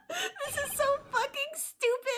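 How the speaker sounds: high, helpless squeaky laughter; laughing. Real, not acted. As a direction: wheeze the words out while cracking up. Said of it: "I can't stop laughing".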